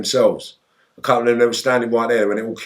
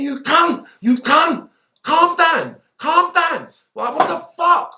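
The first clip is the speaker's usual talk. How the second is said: raging yell; slower than usual. Retold or imitated. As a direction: bellow at them, close to breaking. Retold raging inner shout.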